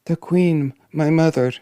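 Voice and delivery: put-on high-pitched young royal voice; worried princess. Princess confides in the dwarf, worried. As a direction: lift the voice into an earnest princess.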